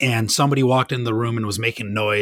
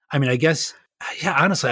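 ordinary talk, nothing marked

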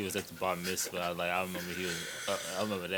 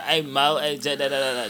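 imitating a Jamaican accent, louder; hammed-up Jamaican accent; mock Jamaican accent; 4 semitones higher, 5 dB louder than usual. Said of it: "the fake Jamaican accent".

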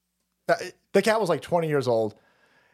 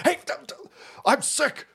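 higher-pitched, fed-up retort; exasperated impression, pitched up; mock-irate general; 8 semitones higher than usual. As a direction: imitate a general cutting someone off, sick of it. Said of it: "General Flynn goes".

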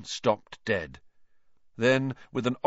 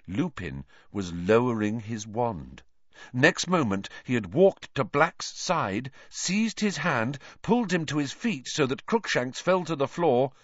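ordinary delivery, nothing marked

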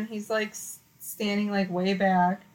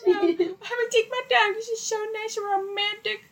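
high, singsong mock-dreamy munching; oblivious la-la land; 13 semitones higher, faster than usual. Girlfriend lost in her sandwich on the date, oblivious and singsong. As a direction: babble happily in a high little voice. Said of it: "in my own little la la land".

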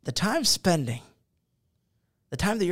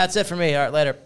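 usual speaking voice

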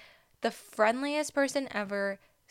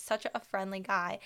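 ordinary talk

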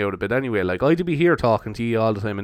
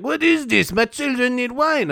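mock French girl; 12 semitones higher, slower than usual. French date baffling the Irishman, high mock French accent. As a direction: imitate a French woman, pitched way up. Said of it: "she's just like".

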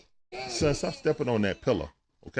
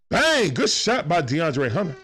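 excited basketball call; 4 semitones higher, slower than usual. Real, not acted. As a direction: punch the big shot like a commentator. Calling a made shot live.

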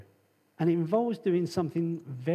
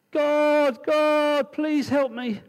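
high, loud desperate cries to God; crying out; 10 semitones higher, 7 dB louder, much slower than usual. Acted. Man hanging from a branch cries loudly to God for help.

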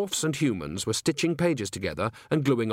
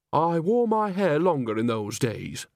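ordinary delivery

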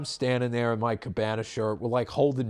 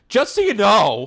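high, loud prim warning voice; impression of the woman; 12 semitones higher, 11 dB louder than usual. Quoting the woman behind them in a high, loud voice. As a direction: mimic her curt warning, pitched up. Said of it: "a very good impression of this woman".

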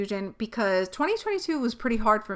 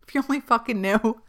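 sardonic laugh; 3 semitones higher than usual. Real, not acted.

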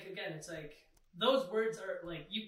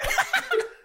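high, loud burst of laughter; shrieking laugh; 10 dB louder than usual. Real, not acted. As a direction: crack up out loud.

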